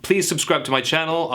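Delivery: normal talking voice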